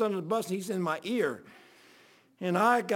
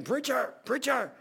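imitated calling; 5 semitones higher, slower than usual. Jim calling again, milder retelling.

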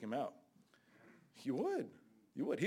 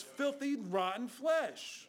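slow disgust; 8 semitones higher, much slower than usual. Acted. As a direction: drag out "rotten flesh" very slowly. Preacher stressing disgust.

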